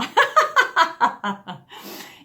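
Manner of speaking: loud, high burst of laughter; real laugh. Real, not acted. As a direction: let the laugh roll out freely. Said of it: "Just let yourself laugh now".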